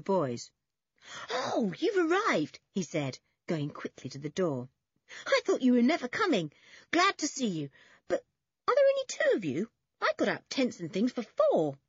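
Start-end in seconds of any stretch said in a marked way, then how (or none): 1.3-2.6 high, drawn-out delighted welcome; excited greeting. A narrator's reading. Toby welcomes the two boys, excited greeting. 5.3-11.8 put-on high, quick boyish chatter; excited greeting. A narrator's reading. Toby expected four visitors, excited quick greeting.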